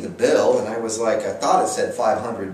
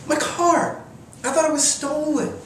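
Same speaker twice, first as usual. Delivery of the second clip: much higher, alarmed squeal of disbelief; panicked shock. Retold or imitated. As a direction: yelp it like your car just vanished. Finds car gone.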